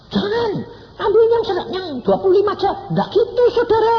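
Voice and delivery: very high, loud mocking falsetto; mock the idea in a squeaky loud voice; high loud parody of a bossy God.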